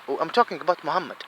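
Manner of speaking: ordinary delivery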